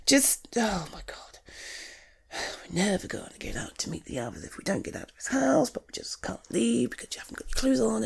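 fast exasperated whisper. Real, not acted. Muttering to herself while her friend won't dress, fast whisper. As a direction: hiss it under your breath, hurried.

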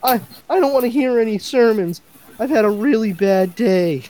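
high, whining complaint; whiny criminal voice. Retold or imitated. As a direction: sulk like a sorry crook. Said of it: "And then he responds".

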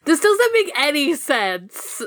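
high-pitched, drawn-out mock complaint; Jellymon. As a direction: protest slowly in a put-on high voice. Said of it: "Jellymon's just like".

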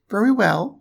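high piglet voice; agreeable little pig. A narrator's reading.

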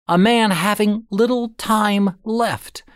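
ordinary delivery

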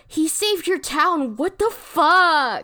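slow, loud, high exclamation; mock outrage. Reading outraged episode notes to co-hosts, loud mock outrage. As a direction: draw it out in loud, high indignation.

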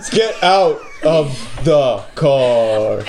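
slow and menacing. Acted. Suspect delivering mugger line.

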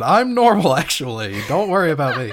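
high, loud defensive protest; loud mock insistence. Sherlock reassuring everyone, a loud mock-defensive protest. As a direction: insist loudly you're fine. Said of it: "Sherlock said".

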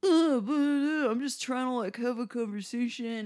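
high, whining mock protest; whiny mock man. Mocking the patron's whiny high protest to the bartender. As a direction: whine like a hurt guy caught out. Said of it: "he kept saying stuff like".

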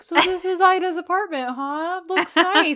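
lightly voiced leading man, pleased with himself; imitating the hero. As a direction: play him casually sizing up the apartment. Voicing the hero in retelling.

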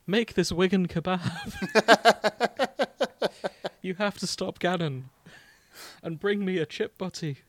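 high, loud laughing northern Zelda; mock Wigan princess, giggling; northern English accent. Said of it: "Princess Zelda from Wigan".

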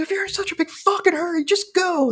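letting rip at rude hikers; angry yell. Retold or imitated. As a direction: Dan shouting at trail hikers, replayed yell. Yelling at rude hikers on trail.

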